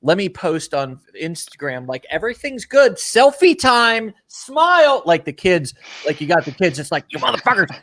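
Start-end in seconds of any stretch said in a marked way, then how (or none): 0.0-5.0 much higher, sing-song mock poser, slowing on selfie time; mock Instagram cheer. Mocking someone posting a perfect life online, high mock cheer. 7.1-7.7 higher, pushed mock scold at the kids; hammed-up parent ordering the kids to smile. Parent forcing crying kids to pose for a photo, mock scold.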